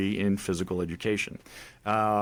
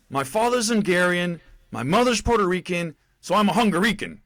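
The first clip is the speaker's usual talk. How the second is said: raised, loud recruit reply, lightly acted; retold recruit's answer; 9 semitones higher, 6 dB louder than usual. Recruit improvises an answer for drill sergeant, retold loud reply. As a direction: sound off the improvised explanation. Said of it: "I said, uh".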